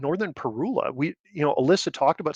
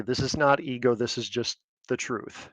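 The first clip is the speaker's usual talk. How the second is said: ordinary talk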